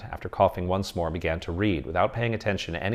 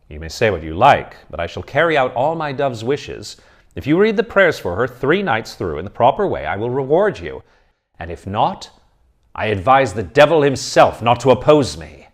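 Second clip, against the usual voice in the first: louder, forceful threat; emphatic; 4 semitones higher, 8 dB louder than usual. Acted. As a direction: lay down the law, no arguing. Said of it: "spoke these last words in such an emphatic way".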